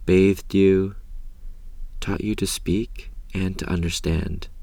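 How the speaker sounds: ordinary talk, nothing marked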